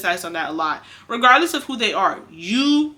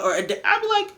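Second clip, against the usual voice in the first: ordinary delivery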